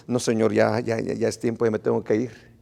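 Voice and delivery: low, quiet, reluctant reply; mumbled excuse. Retold or imitated. Congregant telling the Lord it is time to go, low mumbled excuse. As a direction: make a hushed, low excuse to slip away.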